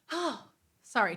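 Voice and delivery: ordinary delivery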